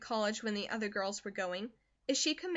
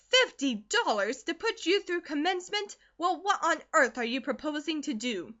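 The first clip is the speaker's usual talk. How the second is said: loud, higher disbelieving mother; incredulous mother; 6 semitones higher, 6 dB louder than usual. Acted. Mother scoffs at fifty dollars, loud and incredulous. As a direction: throw it back at her in disbelief. Narrator voices Mrs Comstock.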